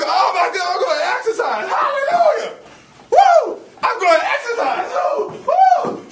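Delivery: loud, high, whooping mock excitement; hyped gym cheer. Preacher mocking a hyped gym-goer, whooping loud and high. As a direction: shout it like you can't wait to work out.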